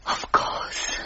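hushed, breathed assent; intimate whisper. Acted. Lucretia inviting Mister Jenkins to confide, intimate whisper. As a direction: whisper it softly, inviting the secret.

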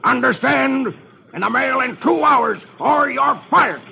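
threatening boss. Acted. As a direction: drive the threat home. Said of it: "Dithers glares at Dagwood".

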